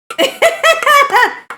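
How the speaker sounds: high squeal of a laugh. Real, not acted. Laughing after the burnt joke.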